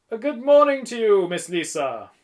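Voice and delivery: loud, high, cheery welcome; effusive shopkeeper's greeting; beaming shopkeeper. A narrator's reading. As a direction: greet a favourite customer with a broad smile. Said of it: "smiling broadly".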